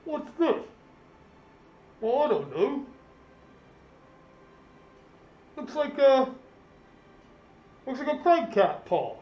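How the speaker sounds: a simple militiaman squinting at what he found; a guard puzzling over his find upstairs. Acted. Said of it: "you can hear one of them go".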